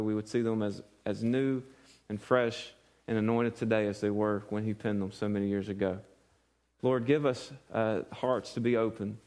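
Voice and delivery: quiet, low prayer voice. Acted. Pastor praying over the congregation, quiet and reverent. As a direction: pray it softly and humbly to God. Opening prayer before the sermon.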